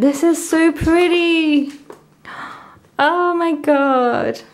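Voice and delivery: real delighted squeal of praise; delighted gushing. Real, not acted. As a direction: gush over something lovely. Thrilled reaction to album.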